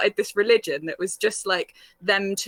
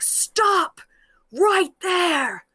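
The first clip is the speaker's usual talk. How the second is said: much higher, commanding stop-right-there call; authoritative shout; 8 semitones higher, 3 dB louder, much slower than usual. Retold or imitated. Military policeman halting drunk sailors, commanding shout. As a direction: bark the order to freeze them in place. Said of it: "it was like an authoritative voice".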